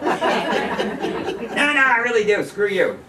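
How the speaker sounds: high, laughing mock protest. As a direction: tease back through laughter. Donna's teasing reply, laughing.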